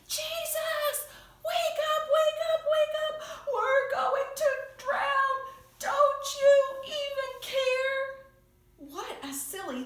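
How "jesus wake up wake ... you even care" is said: frantic, much higher and louder shouting; panicked cry for help. Acted. Disciples shouting at sleeping Jesus in a storm, frantic cry. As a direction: yell to wake the sleeping Jesus. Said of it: "They're going, they're shaking him".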